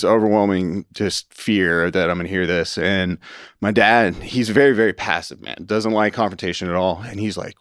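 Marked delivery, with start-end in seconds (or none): none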